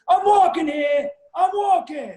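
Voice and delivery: high, loud yell at a cab; acted street-crossing outburst; movie catchphrase shout. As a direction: holler it like an indignant pedestrian. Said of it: "the cab almost hits him".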